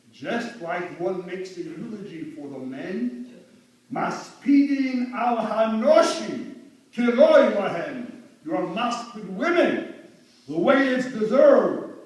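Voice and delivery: loud, high and slow sermon delivery; preaching at full force. Acted. Eulogist teaching that women deserve eulogies, preaching loud and slow. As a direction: proclaim the law to the congregation.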